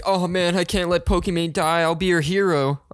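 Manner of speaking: high, put-on earnest pleading. Banned donor rushing to save Pokimane, eager. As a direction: play the gallant simp, hammed up.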